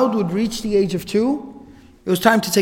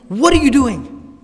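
slow, higher, louder mock scold; 5 semitones higher, 4 dB louder than usual. Mother confronting daughter in the open sun, scolding. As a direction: demand it like an upset mom. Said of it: "you know, scolding".

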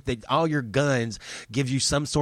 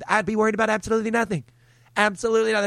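mock carefree; 8 semitones higher, much faster than usual. Mocking carefree attitude.

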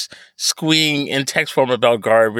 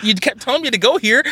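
ordinary talk, nothing marked

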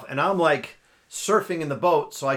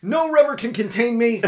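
high, hammed-up victory cry; mock triumphant boast; 8 semitones higher than usual. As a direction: declare it like a conquering hero, pitched high. Retells own triumphant boast in the boat.

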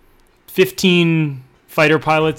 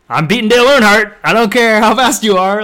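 higher, louder, fast; excited boast; 5 semitones higher, 4 dB louder than usual. Real, not acted. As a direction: insist you'd win the race. Boasting heated argument.

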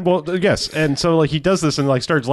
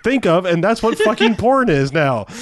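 high, slow, laughing rant; incredulous rant about lazy porn titles, raised and slow; exasperated rant; 6 semitones higher, slower than usual. Retold or imitated. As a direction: retell the outburst at full pitch. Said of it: "I was like".

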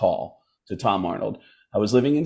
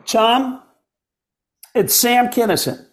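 high, raised comedian voice, full put-on; Sam Kinison; 11 semitones higher, 3 dB louder, slower than usual. Prank caller introduces himself to a star, high put-on voice. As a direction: announce yourself as a dead comic on the phone. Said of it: "that impression is so good".

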